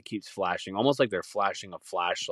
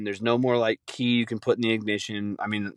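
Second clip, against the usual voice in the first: normal talking voice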